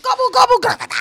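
high, loud turkey gobble; gobbling turkey voice. Shown as a demonstration. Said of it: "the dying turkey".

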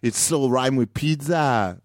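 high European guy, drawn-out ending; general European accent. Pretend. European guy answers host about Ibiza, general European accent. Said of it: "Just general kind of European guy".

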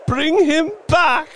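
very high, loud demand; crying out. A narrator's reading. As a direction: shriek it as a desperate mother. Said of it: "cried the old woman".

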